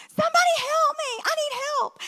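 high, loud yelling for help; retold scream for help. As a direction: cry out for help in the woods like a panicked father. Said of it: "he screams".

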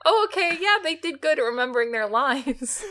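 high, giggling through the words; amused sarcastic quote through laughter; laughing mock praise. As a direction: laugh while faking a compliment. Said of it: "I'm like".

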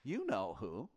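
hushed, secretive murmur; mock whisper. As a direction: lean in and pass on a rumour under your breath. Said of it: "And then the whispers".

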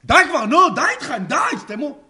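high, loud exasperated shouting; imitated yelling. Imitating three girls telling others to shut up, shouted. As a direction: yell it like a fed-up heckler, high. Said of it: "אתה מכיר את זה שאני צועקות".

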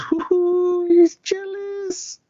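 very high, slow, teasing hoot; mocking falsetto. [name] teasing Magic, mocking very high slow falsetto. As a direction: sing it out high like a taunting flirt.

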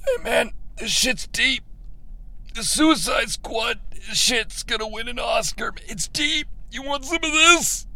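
soft, lazy stoner voice; quiet stoner offering a smoke. Pretend. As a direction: play a stoned writer passing a joint. Stoner voice mocking the script.

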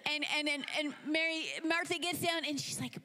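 ordinary delivery

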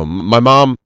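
normal talking voice

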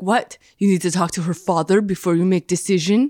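attempted French accent landing Polish-Russian; weird Polish-Russian accent. Timeshare saleswoman belittling a husband, weird Polish-Russian accent. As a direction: put on a muddled Polish-Russian accent, indignant. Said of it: "it's always this weird Polish Russian accent".

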